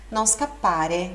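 "scappare" with a strong held double p, slowly; strong double consonant. Shown as a demonstration. Teacher showing double consonants, slow strong pp in "scappare". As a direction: lean on the pp in "scappare". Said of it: "dá aquele som mais forte".

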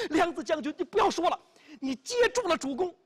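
loud, high urgent call; excited. A narrator's reading. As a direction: call out, overcome with emotion. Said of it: "他很激动啊".

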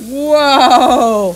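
amazed whoa. Real, not acted. Mom sees muddy mess.

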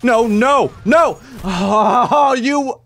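high, loud protest; frustrated cry. Real, not acted. Gamer watching himself get killed, frustrated cry. Dying in game.

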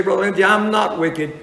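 mock the self-righteous objector lightly; imitating a listener. Preacher voicing a churchgoer denying he is wicked, mildly mocking. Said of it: "you say".

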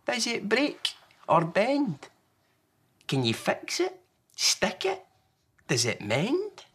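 put-on high, slow anxious child voice; Small, worried little one. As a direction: tumble out worried questions.

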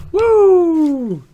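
high, loud whoop of relief; excited cheer. Real, not acted. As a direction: cheer like you won. Relief at easy pudding.

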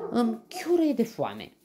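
loud, high hungry whine; hungry complaint. A narrator's reading. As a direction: complain loudly about an empty belly. Hungry character whining in story. (plângăcios, înfometat)

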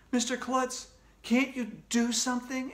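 put-on high-pitched child's plea; upset appeal. A narrator's reading. Upset pupil appeals to principal.

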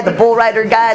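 normal talking voice

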